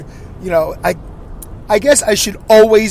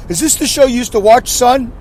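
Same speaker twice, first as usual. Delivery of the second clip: imagined dad voice; 7 semitones higher than usual. Retold or imitated. Imagined father asking son.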